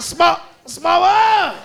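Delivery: put-on high, loud, drawn-out toddler holler; three-year-old tough kid. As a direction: holler it slowly like a bad little kid. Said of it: "the baddest one was three years old".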